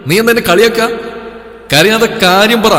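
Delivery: worked-up, high-pitched questioning; exasperated demand. A narrator's reading. Exasperated man demands why she cries.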